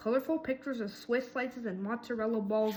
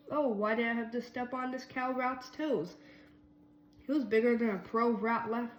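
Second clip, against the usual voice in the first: usual speaking voice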